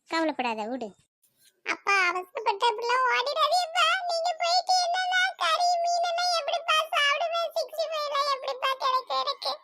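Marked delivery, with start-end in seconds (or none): 1.6-9.5 high-pitched, whining plea; pleading. Acted. Son begs father not to leave. (கெஞ்சும் உயர்ந்த சிணுங்கல் குரல்)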